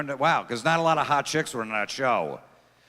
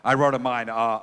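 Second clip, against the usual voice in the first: ordinary talk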